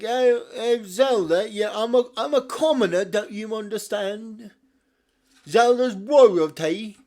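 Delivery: loud, hammed-up British commoner voice; obnoxious British Link. Mocking a hero as a loud British commoner talking to royalty. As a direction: ham up a rowdy British bloke accent. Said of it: "obnoxiously British".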